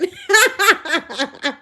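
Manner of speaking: high, real burst of laughter; incredulous laughter. Real, not acted. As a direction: crack up in disbelief. Cracks up at the claim.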